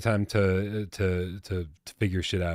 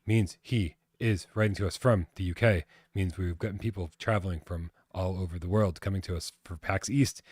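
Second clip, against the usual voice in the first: usual speaking voice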